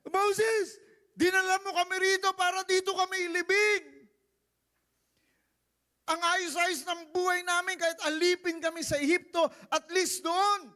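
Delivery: high, loud whiny wail to Moses; high whining Israelites blaming Moses; wailing complaint. Acted. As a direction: whimper it out like crying children. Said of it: "Iyak sila".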